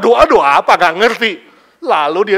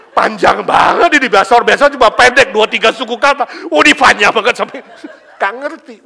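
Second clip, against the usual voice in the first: excited congregation; 4 semitones higher, 10 dB louder than usual. Retold or imitated. Congregation raving about tongues.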